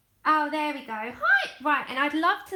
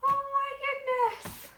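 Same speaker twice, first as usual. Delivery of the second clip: high, slowed, breathless wonder; overwhelmed; 11 semitones higher than usual. Real, not acted. Thanking the viewer who sent the gift, awed and overwhelmed. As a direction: melt at the sight. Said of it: "I'm so overwhelmed".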